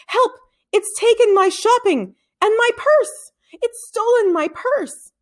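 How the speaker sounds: high, loud cry for help; old woman calling out. A narrator's reading. Old woman calling for help in the park, high and loud. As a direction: shriek it out like a robbed old lady. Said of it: "called to anyone who could hear".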